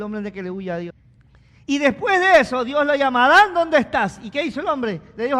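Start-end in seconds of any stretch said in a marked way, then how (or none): 1.6-5.4 much louder, higher preaching shout; full-force pulpit cry; fiery preaching. Acted. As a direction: drive it home to the congregation.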